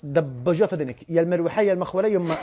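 ordinary delivery

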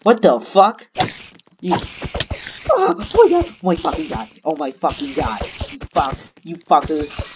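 high, loud panicked outburst; startled yelling. Real, not acted. Player cursing out an attacker in the game, startled yelling. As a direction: yell in sudden fright.

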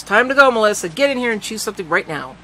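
put-on loud, harsh command to the girl; angry order. A narrator's reading. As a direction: bark the order at her. Neil ordering Melissa angrily.

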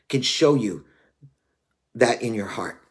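ordinary talk, nothing marked

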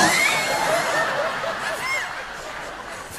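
very high shrieking laugh. Real, not acted. Comic breaking up on stage, a high shriek of laughter.

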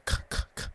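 bare "k" sounds, each a puff of air. Shown as a demonstration. Explaining the consonant to viewers, isolated aspirated "k" sounds. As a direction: repeat "k" as short airy bursts. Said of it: "puff of air whenever you say k".

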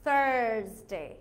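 American Thursday with a heavy R; American accent. Shown as a demonstration. Teacher contrasting the two accents, American accent. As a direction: stretch the American R in "thurrs-day". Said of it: "So American accent it will be".

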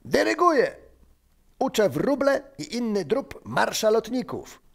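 high-pitched, exalted announcement; tipsy man proclaiming. Acted. Benek shouts grandly from the tree. (wysokie, podniosłe obwieszczenie)

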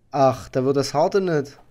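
ordinary delivery

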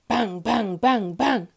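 four loud, even bangs; loud pounding on a door; bomb-like knocking. A narrator's reading. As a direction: hammer each bang out. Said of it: "noise which sounded like bombs dropping".

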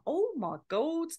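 hammed-up dawning disbelief; retold shock. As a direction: let the penny drop slowly. Realising he really is surgeon.

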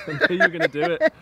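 high, fast, laughing through words. Real, not acted. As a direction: crack up mid-sentence. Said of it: "Yeah, I knew you were gonna do it".

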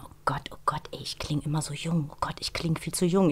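put-on whispering her old panic to herself; hushed, fretful inner voice; worried whisper. Actress recalling fearing she sounds too young, whispered. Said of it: "da dachte ich mir".

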